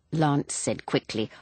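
usual speaking voice

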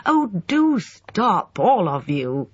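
high, drawn-out pleading; upset, slow high-pitched appeal; distressed cry. A narrator's reading. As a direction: beg them all to stop, voice lifted high. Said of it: "cried Emma".

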